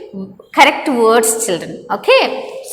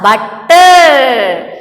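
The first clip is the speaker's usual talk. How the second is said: butter louder, stretched out slowly; stress on butter; 4 dB louder than usual. Shown as a demonstration. Teacher shows children the double-letter word, stressed butter. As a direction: draw out "butter" with a forceful second syllable. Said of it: "we are stressing the word here".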